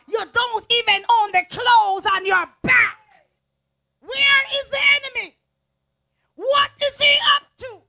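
high, loud shouted praise; preaching at full force. Acted. Preacher rousing the church, shouting praise to God. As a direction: shout it to the rafters.

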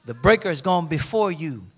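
usual speaking voice

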